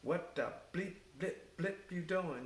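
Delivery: imitating an indignant captain, pitched high; retold pissed captain. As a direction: confront them, acting out the captain's anger. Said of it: "He was pissed".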